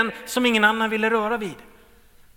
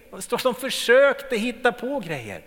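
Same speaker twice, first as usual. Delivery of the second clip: ordinary delivery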